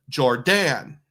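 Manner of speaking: Jordan said as "jor-DAN", with a full "Dan" ending; "Jordan" with a drawn-out second syllable rhyming with "Dan"; imitated mispronunciation. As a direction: quote his wrong Jordan, jor-DAN. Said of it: "He said".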